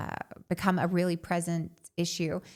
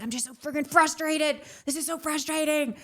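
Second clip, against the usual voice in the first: higher-pitched, acted-out frustration; exasperated outburst; 8 semitones higher than usual. Retelling what she said at every turn, exasperated. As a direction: replay being fed up, pitched well up. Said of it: "verbally said the words, I'm just so frustrated".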